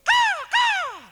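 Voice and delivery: very high, loud crow squawk; piercing falsetto caws. Acted. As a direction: screech it out like a crow. Crow's cry inside children's song.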